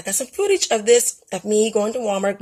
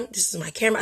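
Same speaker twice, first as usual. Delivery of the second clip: ordinary talk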